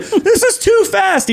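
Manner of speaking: high, drawn-out mock wail; mock frightened kid. Dad mocking his son on the roundabout, high frightened whine. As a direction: imitate a scared child squealing, half laughing.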